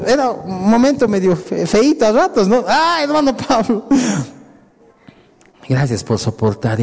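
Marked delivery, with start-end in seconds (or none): 2.7-3.8 laughing teasing call. Retold or imitated. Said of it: "soportar el chiste".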